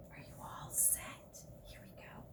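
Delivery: soft whispered aside; hushed, conspiratorial whisper. Real, not acted. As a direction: lean in and whisper it. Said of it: "whispered aside to listener".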